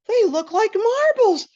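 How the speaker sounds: amazed at the meatballs; giddy wonder. Retold or imitated. Giggly high observation.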